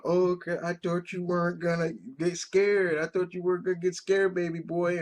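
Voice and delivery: a hint of mom laughing at her son; mother teasing. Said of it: "she's going".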